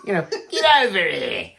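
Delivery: high, hammed-up East End orc; exaggerated Cockney orc calling out, played for laughs; Cockney monster. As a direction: shout it like a cartoon Cockney monster. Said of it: "a Cockney for, for, like, these, like, uh, monsters".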